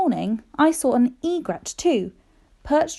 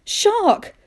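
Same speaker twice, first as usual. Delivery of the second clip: even higher alarmed exclamation; a second shocked cry, pitched up hard; 9 semitones higher, 3 dB louder than usual. Retold or imitated. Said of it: "I thought".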